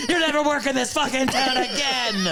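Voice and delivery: high, loud hammed-up yelling at an underling; mock scream. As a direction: bellow it like a furious boss in scrubs. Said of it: "screaming at a twenty-two-year-old".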